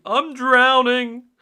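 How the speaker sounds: high, loud, slow drawn-out wail; panicked cry. A narrator's reading. Mister Grinling thinks he is drowning, high panicked wail. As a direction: panic as if going under. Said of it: "panicked cry while drowning".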